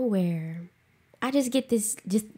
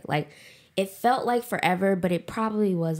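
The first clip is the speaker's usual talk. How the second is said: ordinary delivery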